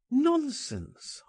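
sharp high dismissive "Nonsense"; scoffing. A narrator's reading. Narrator dismissing his friend's fear, high scoff. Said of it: "I replied".

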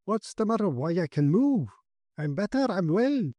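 high, swooping astonishment; amazed excitement. A narrator's reading. Paralysed Loring discovering he can move, amazed excitement.

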